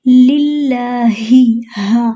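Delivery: लिल्लाहि with a breathy throat ह, drawn out slowly; tajweed ha sound. Shown as a demonstration. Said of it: "यहाँ पे हा है". (लिल्लाहि में हल्क़ से हा)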